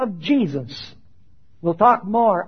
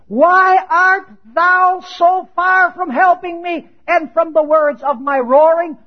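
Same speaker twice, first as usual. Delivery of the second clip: loud, slow, lifted pleading cry; roaring plea; 7 semitones higher, 6 dB louder, slower than usual. Acted. Preacher voicing the psalmist roaring to God, slow loud plea. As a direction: read it as a roar to heaven.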